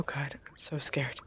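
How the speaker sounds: soft frightened whisper; scared whisper. Real, not acted. Gamer hiding from a monster, scared whisper.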